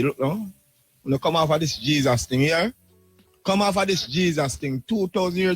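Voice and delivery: ordinary delivery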